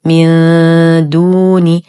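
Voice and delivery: slow من دون, ن hidden in a long thin hum before د; light ikhfa. Shown as a demonstration. Teacher shows noon before daal, light full ghunnah. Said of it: "إخفاء حقيقي مع غنة كاملة مرققة".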